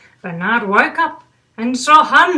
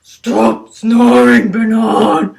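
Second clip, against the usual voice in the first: grumpy animal; 8 dB louder, slower than usual. Acted. Another animal yells at Bernard.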